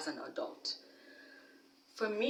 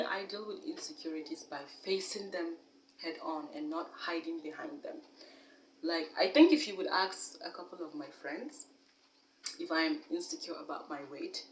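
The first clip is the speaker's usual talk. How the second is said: ordinary talk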